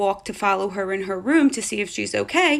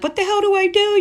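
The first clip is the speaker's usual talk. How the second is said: high, loud panicked cry; 12 semitones higher, 6 dB louder than usual. Retold or imitated. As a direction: squeal it in a total panic. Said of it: "I'm freaking out".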